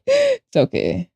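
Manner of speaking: squeaky self-comfort; high soothing. Pretend. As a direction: coo it to yourself, slow. Consoling herself after mock wail.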